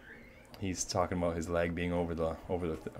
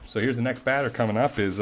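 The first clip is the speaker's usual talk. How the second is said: usual speaking voice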